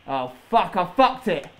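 ordinary talk, nothing marked

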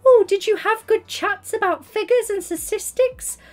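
pry in a high teasing coo; mock nosy gossip. Streamer teases her friend about chats with a coworker, high and cooing. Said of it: "I'll be like".

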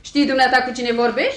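offended rebuke; indignant challenge. Acted. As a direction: demand it sharply, rising at the end. Affronted woman challenges intruder.

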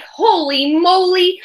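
excited. Acted. Excited exclamation.

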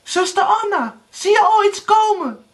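very high, urgent cry; frantic calling. A narrator's reading. Erika cries to Anna in the tower, frantic. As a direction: call up with mounting panic. Erika calls up once more.

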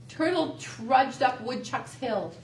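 ordinary delivery, nothing marked

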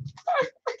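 a quick squeaky giggle, high up and soft. Real, not acted. Reaching the end of the box, a soft squeaky giggle. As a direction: let a tiny high giggle slip out.

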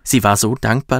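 usual speaking voice

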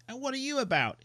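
high, loud bratty challenge; a boy demands to know your business; demanding boy. Acted. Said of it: "he demands".

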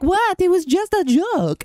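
squeaky high whine of the friend brushing it off; high mocking friend. Friend mocked brushing off his joke as harmless. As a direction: play the friend shrugging it off innocently. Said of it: "He's like".

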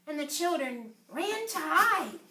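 ordinary delivery

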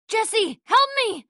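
high, frantic cry for help; desperate plea, pitched high; calling for help. Acted. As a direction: scream his name for rescue. Character calls for help.

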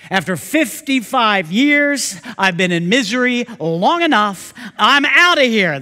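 hammed-up fed-up father; fed-up old father. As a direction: blurt it out like a fed-up old man. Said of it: "he calls up his son".

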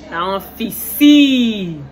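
slow, loud Liberian phrase; drawn-out Liberian slang; Liberian accent. Shown as a demonstration. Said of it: "Liberian accent".

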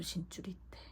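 hushed secretive whisper. Retold or imitated. Telling the shaman in a secretive whisper.

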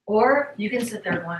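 ordinary delivery

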